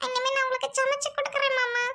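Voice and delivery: wife pretending to cry to her husband; fake crying. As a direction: fake tears to win him over. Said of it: "நீ அழுகுறியா?".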